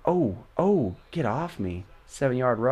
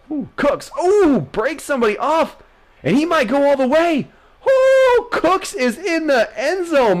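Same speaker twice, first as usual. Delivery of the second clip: high, loud, laughing commentary; excited play-by-play; 12 semitones higher, 5 dB louder, slower than usual. Real, not acted. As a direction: hype the run like a commentator. Calling a touchdown run.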